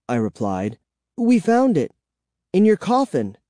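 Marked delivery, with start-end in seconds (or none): 1.2-3.4 excited. A narrator's reading. Excited child answers.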